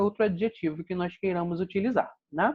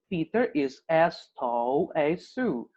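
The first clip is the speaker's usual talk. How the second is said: slow, deliberate English example; slow model sentence; much slower than usual. Shown as a demonstration. Teacher modelling an English comparative for students, slowly and deliberately. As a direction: read it slowly, word by word for learners.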